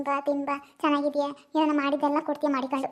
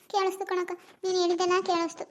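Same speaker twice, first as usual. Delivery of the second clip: ordinary talk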